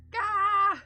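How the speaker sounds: 1 yawn groan. Real, not acted. End of a big yawn.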